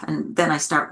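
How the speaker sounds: usual speaking voice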